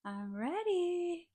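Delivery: high, slow, drawn-out proud call; massive announcement. Retold or imitated. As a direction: announce it big to the whole room. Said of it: "a massive".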